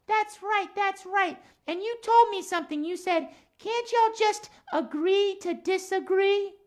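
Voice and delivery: lilting cat character voice; Max the cat. Acted. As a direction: play a chatty cat puppet for children. Puppet cat Max talks.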